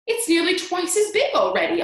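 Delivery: loud, high-pitched astonished cries; excited shout. A narrator's reading. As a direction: shout it in mounting amazement. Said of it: "Aunt Spiker shouted".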